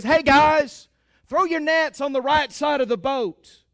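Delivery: loud call across the water; Jesus hails the fishermen from shore. Acted. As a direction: shout it out to the boat. Jesus calls from the bank.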